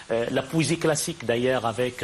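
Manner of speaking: usual speaking voice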